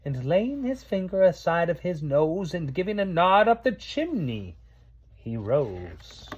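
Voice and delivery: rhythmic storybook poem recitation; poem reading. Acted. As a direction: read it like a bedtime Christmas poem. Reads Christmas poem aloud.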